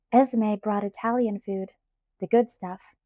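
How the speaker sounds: ordinary delivery, nothing marked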